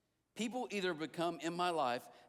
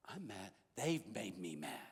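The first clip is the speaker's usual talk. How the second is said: mock sulk; 11 semitones lower, 5 dB quieter, slower than usual. Retold sulking thought.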